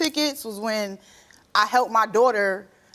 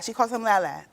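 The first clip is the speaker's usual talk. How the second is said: normal talking voice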